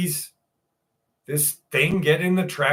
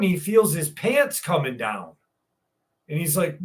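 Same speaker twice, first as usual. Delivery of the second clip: ordinary talk, nothing marked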